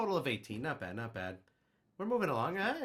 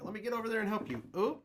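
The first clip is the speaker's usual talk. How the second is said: high excited mock apology; 10 semitones higher, 4 dB louder than usual. Acted apology to printer.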